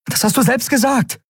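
hoch und aufgebracht; empörter Vorwurf. Acted. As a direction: halte es ihm erregt vor. Endriel fährt Kai empört an. (aufgebrachter, hoher Vorwurf)

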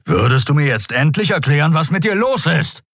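exasperated demand climbing in pitch; Keru, fauchend. A narrator's reading. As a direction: demand an answer, voice rising to the end. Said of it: "fauchte Keru". (aufgebracht, immer höher werdend)

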